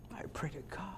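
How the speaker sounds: soft unvoiced prayer; whispered prayer. Real, not acted. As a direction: breathe it as a private prayer. Quiet prayerful aside.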